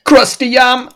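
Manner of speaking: high-pitched comic cry; playful outburst. Pretend. Co-host mangling a yam's name, playful high outburst. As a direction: blurt it high and silly.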